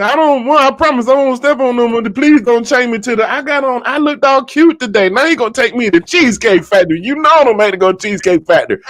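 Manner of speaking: high, whiny begging; pleading woman. Acted. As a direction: beg him like a woman in trouble. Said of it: "She said".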